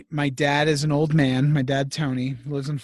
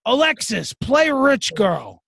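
high, slow, drawn-out call to the device; a high-pitched father slowly commanding Alexa; old dad command; 6 semitones higher, 4 dB louder, slower than usual. Retold or imitated. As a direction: stretch out the order like a stubborn old man. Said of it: "and he goes".

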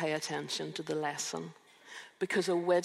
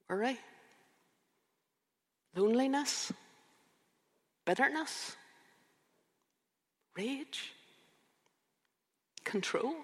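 rising questions; 7 semitones higher than usual. Acted. Rhetorical list of names.

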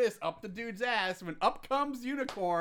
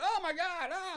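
mock scream; 9 semitones higher than usual. Acting out the man being cut, mock scream. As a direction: imitate a scream of pain at restrained volume. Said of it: "The guy's screaming".

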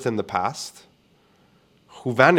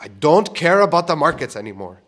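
louder, higher, fed up; exasperated outburst; 6 semitones higher, 7 dB louder than usual. Retold or imitated. Venting about the client who won't stop talking, exasperated. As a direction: blurt it out sick of the topic.

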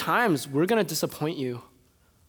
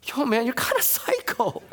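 imagined listener; 8 semitones higher than usual. Retold or imitated. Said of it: "some of you are like".

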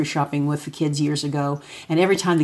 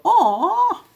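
squeaky high Aw with a funny face; son's goofy joke, very high Aw. Retold or imitated. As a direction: pitch the Aw way up like a goofy kid. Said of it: "and then he'll go".

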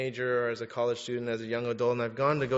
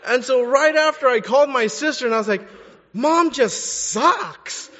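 much higher and louder, worked-up complaining; heated venting; 10 semitones higher, 11 dB louder than usual. Retold or imitated. Son venting to his sister about mom, higher louder worked-up. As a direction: vent like you're on the phone fuming.

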